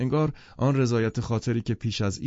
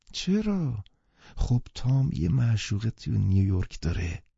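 doubtful; 3 semitones higher than usual. Acted. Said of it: "با شک پرسید".

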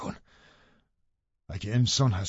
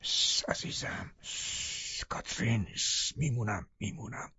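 soft, whispered shushing; calm your beloved in a whisper; tender, barely voiced reassurance; soothing hush; 12 dB quieter, slower than usual. A narrator's reading. Lover hushes the screaming Catherine.

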